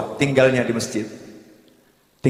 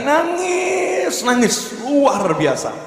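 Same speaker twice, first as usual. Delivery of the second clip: "nangis" stretched long on a high held pitch; slow, soaring stress on "nangis" and "luar biasa"; drawn-out emphasis; 10 semitones higher, much slower than usual. Retold or imitated. Preacher telling of a woman weeping terribly, drawn-out emphasis.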